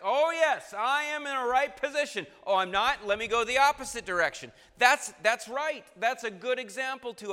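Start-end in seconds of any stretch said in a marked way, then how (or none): 0.0-4.5 high, loud emphatic protest then turnaround; emphatic Peter. Retold or imitated. Preacher acting Peter flipping his position, loud and high. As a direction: belt it out as a stubborn disciple. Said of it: "Peter was emphatic".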